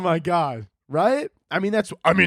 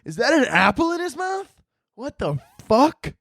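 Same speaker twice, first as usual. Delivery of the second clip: high, incredulous question, slowing on the swear; shocked saint; 10 semitones higher than usual. Acted. Saint looking at an apple in his mouth, shocked. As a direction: squeak it out in baffled horror.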